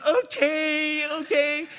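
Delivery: put-on high toddler voice. Voicing toddler's reply.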